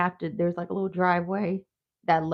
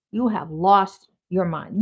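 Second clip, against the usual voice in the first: normal talking voice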